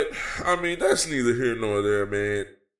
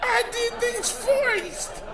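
mocking Cody Rhodes. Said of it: "He's just out there basically saying".

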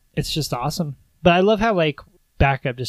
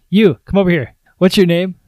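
loud, higher, ordering a hostage over; loud commanding villain; 4 semitones higher, 11 dB louder than usual. Retold or imitated. Villain Hans calling a hostage forward, loud and commanding. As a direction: snap the order out loud and sharp.

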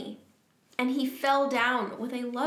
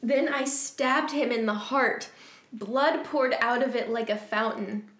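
ordinary delivery, nothing marked

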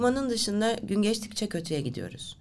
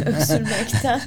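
laughing; 6 dB louder, slower than usual. Real, not acted. Laughs off teary coughing.

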